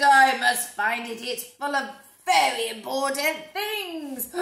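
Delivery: loud, urgent, slow; alarmed Mister Brown. Acted. Mister Brown fretting loudly about his briefcase. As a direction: plead it with alarm.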